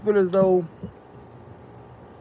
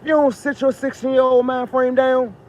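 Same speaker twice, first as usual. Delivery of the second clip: high and loud scolding; loud, high dressing-down; scolding outburst; 8 semitones higher, 5 dB louder than usual. Real, not acted. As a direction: tell him off hard. Scolding the boastful president.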